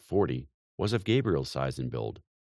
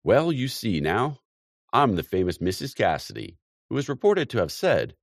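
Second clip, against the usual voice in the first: sidling mimicry; 6 semitones higher, 6 dB louder than usual. A narrator's reading. Mr Brown mimicking Missus Cassidy for the ladies, sly and high. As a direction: wheedle it like a sly gossip. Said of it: "said with sidling mimicry".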